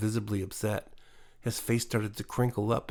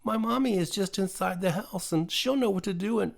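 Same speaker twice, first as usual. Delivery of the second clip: a hint of a child reassuring a hurt animal; gently voiced young girl; comforting little girl; 7 semitones higher than usual. A narrator's reading.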